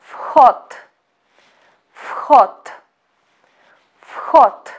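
vhod with the first letter said as f, fhod; Russian vhod. Shown as a demonstration. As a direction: vhod said slowly as fhot, final d as t. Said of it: "Please pay attention to the pronunciation".

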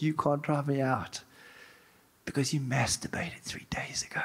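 raspy hushed demon hiss; evil demon whisper. Retold or imitated. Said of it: "But this evil voice".

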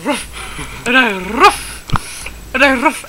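hammed-up thespian bulldog with a big "ruff"; slow, stagey bark on the last word; theatrical dog. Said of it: "That's from Oliver and Company".

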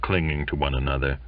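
ordinary delivery, nothing marked

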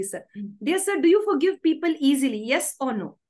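ordinary talk, nothing marked

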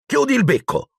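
high, annoyed retort; irritated boyish command; sharp order. A narrator's reading. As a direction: order a teasing brother to stop. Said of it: "intimò Ron".

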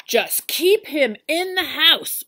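loud, slow stern farmer command; ordered. Acted. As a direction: lay down the law. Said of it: "ordered Farmer Brown".

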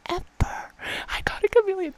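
high, loud burst of joy; excited. Real, not acted. Gamer shrieking that she got a chameleon. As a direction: blurt it out in delight. Shock at rare drop.

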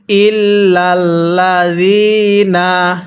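ইল্লাল্লাযিনা chanted slowly, lam doubled, ya stretched one alif; tajweed recitation. Shown as a demonstration. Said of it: "আরেকবার পড়ুন".

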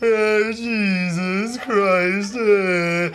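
a hint of a mumbling old farmer; grunting old father. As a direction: grunt it out like a man who only talks to cows. Father grunting like a cow-man.

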